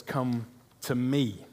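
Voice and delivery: drawn-out, unhurried "Come to me"; a slow, lingering invitation. Shown as a demonstration. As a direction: stretch "Come to me" slowly, letting each word land. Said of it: "Jesus's words said twice".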